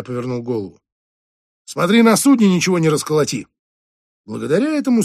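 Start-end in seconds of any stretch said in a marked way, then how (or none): 1.6-3.5 louder, fast sarcastic shout; parting jab. Acted. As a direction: fire off a last dig.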